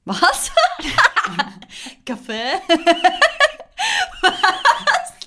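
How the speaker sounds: high, incredulous laughter; laughing disbelief, high pitch. Real, not acted. Laughing at the pronunciation. (lachend und ungläubig)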